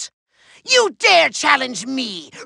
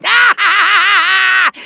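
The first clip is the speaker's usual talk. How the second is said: gloating laugh; 2 semitones higher, 3 dB louder than usual. Acted. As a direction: gloat with a long theatrical ha-ha-ha. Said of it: "That's me gloating".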